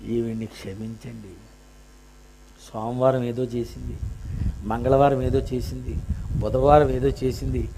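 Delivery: soft, low voice of the blood begging mercy; hushed pleading. Acted. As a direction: plead quietly on her behalf.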